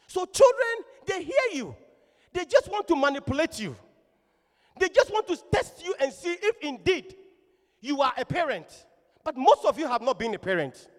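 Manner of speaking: loud, high preacher's exhortation; forceful preaching. Real, not acted. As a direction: admonish the congregation with full force.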